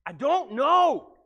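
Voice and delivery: slow, loud, high "I don't know"; drawn-out thief. Retold or imitated. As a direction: stretch out the helpless shrug.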